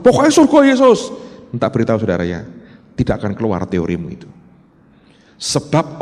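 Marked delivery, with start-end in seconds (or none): 0.0-1.1 mock stubborn insistence. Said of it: "Anda nggak bisa bilang gini".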